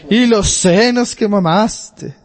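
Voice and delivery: lightly voiced crowd woman, high and slow; a touch of the woman crying out from the crowd; mock crowd woman's exclamation; woman praising from crowd.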